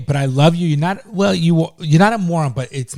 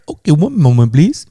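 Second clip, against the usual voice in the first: loud fake Indian accent; Indian-accented agent putting a caller on hold; mock Indian accent; 8 dB louder than usual. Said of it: "with an Indian accent".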